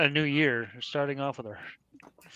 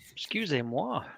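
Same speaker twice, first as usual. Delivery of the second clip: whispering; 10 dB quieter than usual. Acted. Sighing French apology.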